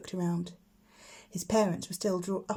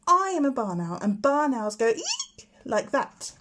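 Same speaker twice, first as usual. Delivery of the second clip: cross; 5 semitones higher, 5 dB louder, slower than usual. Acted. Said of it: "getting very cross".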